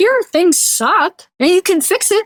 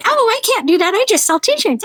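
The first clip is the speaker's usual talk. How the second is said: higher-pitched mimicked protest; mock excuse-maker; 8 semitones higher than usual. Imagined t-shirt seller dodging customer calls, mock protesting voice.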